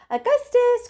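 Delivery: high, loud cry of his name; mother crying out to her son. Acted. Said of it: "cried Missus Gloop".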